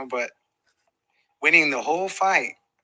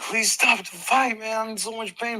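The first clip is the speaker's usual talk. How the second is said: higher, slow, pained begging; pleading; 9 semitones higher than usual. Retold or imitated. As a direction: beg the corner to end it. Retold begging in the corner.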